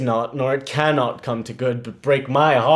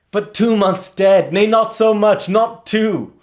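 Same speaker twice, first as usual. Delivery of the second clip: rising outburst; 6 semitones higher, 6 dB louder than usual. Acted. Hamlet's bitter outburst.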